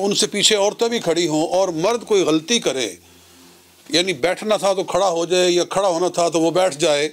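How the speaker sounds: ordinary delivery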